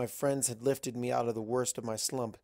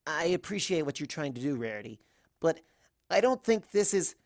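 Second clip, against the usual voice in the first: lightly voiced, a touch higher; Twilight's voice; 5 semitones higher than usual. Twilight trying to refuse Rarity, lightly voiced and uneasy. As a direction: start to decline, politely uneasy.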